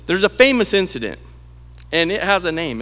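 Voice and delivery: normal talking voice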